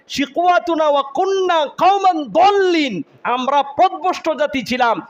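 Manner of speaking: high, loud preaching cry; impassioned wailing plea. Acted. Hell-dwellers confess to their Lord, loud high-pitched plea. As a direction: cry out to the Lord, stretching phrase ends. Voices damned pleading with God.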